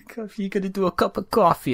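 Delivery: put-on playful New York accent. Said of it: "New Yorker bit".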